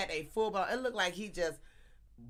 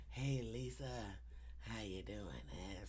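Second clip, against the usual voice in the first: smooth flirting guy; 9 semitones lower, 12 dB quieter than usual. Retold or imitated. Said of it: "he came over there leaning".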